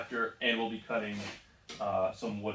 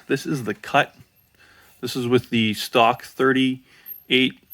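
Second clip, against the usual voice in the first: louder, higher, calling over the noise; raised voice over machine; 4 semitones higher, 7 dB louder than usual. Real, not acted. Woodworker talks to viewers over running saw and collector, raised voice. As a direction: talk up over the roaring dust collector.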